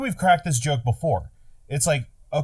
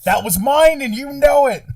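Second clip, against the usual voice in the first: high, loud aggrieved protest; hammed-up squabbling yell; mock indignation; 12 semitones higher, 6 dB louder than usual. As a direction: protest like a cheated rival. Acted complaint over stolen F-bomb.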